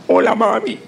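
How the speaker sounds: imitating her son's cracking voice; voice breaking. Son calling mom from boot camp, voice breaking. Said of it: "con la voz quebrantada".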